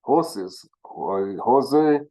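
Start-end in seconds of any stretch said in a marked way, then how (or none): 1.4-2.0 "Jose" said with an h sound, higher and louder; emphatic native "Jose" with an h; Spanish-style name. Shown as a demonstration. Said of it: "like they say".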